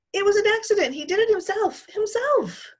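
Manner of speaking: high, loud tearful insisting; girl begging to be believed; pleading girl. Acted. Sheila pleading, crying.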